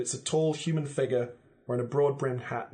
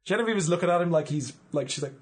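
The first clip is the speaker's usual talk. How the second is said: ordinary talk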